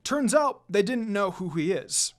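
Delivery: usual speaking voice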